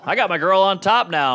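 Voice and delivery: ordinary talk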